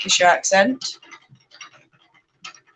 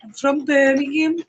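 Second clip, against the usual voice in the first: high, rising mock Brummie; exaggerated Birmingham lilt, rising at the end; Brummie accent; 6 semitones higher than usual. Shown as a demonstration. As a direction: put on a Birmingham accent, pitched up. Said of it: "started talking like a brummie".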